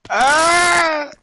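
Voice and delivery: high, awed cry of delight at the meat; ecstatic ah. Real, not acted. Podcast host to friends, picturing the juices: a high, awed cry. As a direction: let out a high, drooling gasp of pleasure. Gawking at juicy prime rib.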